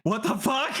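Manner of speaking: high, incredulous burst through laughter; real laughing outburst; laughing disbelief. Real, not acted. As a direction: crack up in real shock. Listener reacts to the punchline.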